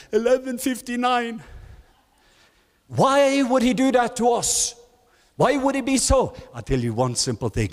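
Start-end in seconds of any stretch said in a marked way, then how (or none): 0.1-6.3 high, plaintive complaint to God; frustrated believer. Retold or imitated. Preacher voicing a believer complaining God came late, high and plaintive. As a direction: whine like someone still waiting at midnight.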